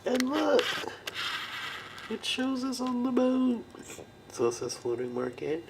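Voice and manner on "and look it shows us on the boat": high and loud with delight; thrilled, gushing over a gift; excited. Real, not acted. Excited over a souvenir plate.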